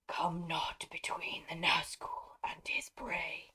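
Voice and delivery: slow, hoarse whisper of the Nazgul; creepy wraith whisper. Acted. The Nazgul threatening Dernhelm, in a slow eerie whisper. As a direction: breathe the threat like a ghost. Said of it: "can you hear me when I talk like that".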